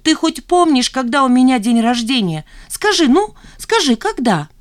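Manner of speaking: high, loud challenge; exasperated wailing. A narrator's reading. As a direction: demand an answer in outrage. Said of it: "завопила Жанна". (возмущённо, почти вопя)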